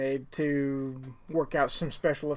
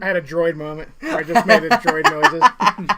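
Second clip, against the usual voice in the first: laughing; 9 dB louder than usual. Real, not acted. Laughing about his slip.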